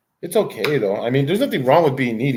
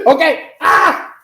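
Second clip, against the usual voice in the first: higher, louder startled cry; startled yelp at the dog; 12 semitones higher, 7 dB louder, much slower than usual. Real, not acted. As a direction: jump as the dog lunges. Dog attacks.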